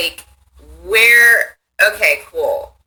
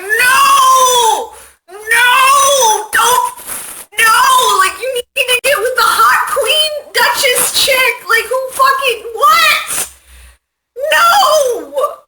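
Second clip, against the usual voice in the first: much higher and louder, yelling in disbelief at the screen; outraged yelling; 4 dB louder, much slower than usual. Retold or imitated. Viewer yelling at the film's hero, high outraged shout. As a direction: scream at the hero to stop, outraged. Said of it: "Like, I was so pissed".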